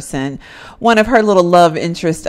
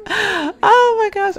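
drawn-out oh my gosh; 12 semitones higher, much slower than usual. Retold or imitated. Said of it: "Penny's like".

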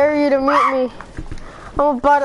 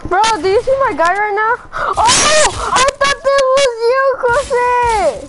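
shrill panicked yelp; panicking gamer; 7 semitones higher than usual. Real, not acted. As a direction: cry out in a gaming panic. Sudden fright in game.

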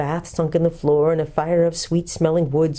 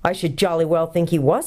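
ordinary delivery

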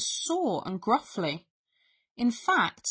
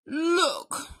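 weak, raised bear wheeze; wheezed; 8 semitones higher than usual. A narrator's reading. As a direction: wheeze it out pitifully. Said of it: "Bear wheezed".

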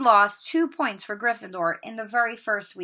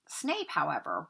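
ordinary delivery

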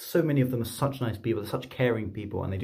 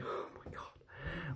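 slow, breathless whispered disbelief; retold awed whisper; 5 semitones higher, 17 dB quieter, much slower than usual. Paramedic stunned by free breakfast, slow whisper.

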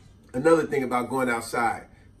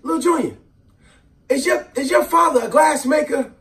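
high, loud mock aunt calling out; aunt's loud call; 10 semitones higher, 5 dB louder than usual. Aunt calling across the room, high and loud. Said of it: "my aunt would say something to me".

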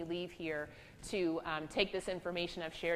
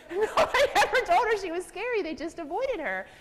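higher, louder, laughing through it; 13 semitones higher, 11 dB louder than usual. Real, not acted. Speaker laughing to the audience, high and loud. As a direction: laugh at the irony.

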